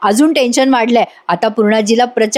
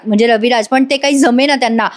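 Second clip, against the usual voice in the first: usual speaking voice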